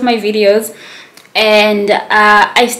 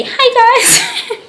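very high, louder hello, breaking into a laugh; high-pitched greeting; 5 dB louder than usual. Retold or imitated. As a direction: exaggerate your squeaky vlogger greeting. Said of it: "my voice just sounds different".

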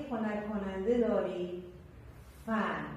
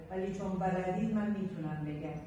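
ordinary talk

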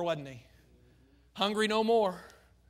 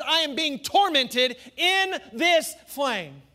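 tormented man; 8 semitones higher, 8 dB louder, slower than usual. Acted. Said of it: "the man says".

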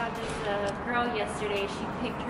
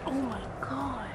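whispering; 4 semitones higher, 5 dB quieter than usual. Real, not acted. Awed by interior.

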